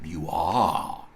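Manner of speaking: low, drawn-out menacing question; a hint of a sly old miser; mock gruff Scrooge. Scrooge teasing his late clerk, low and slow. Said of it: "repeated Scrooge".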